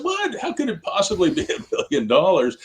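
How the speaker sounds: imitating skeptics who think the price is absurd; incredulous doubters. People scoffing at his billion-dollar prediction, mock incredulous.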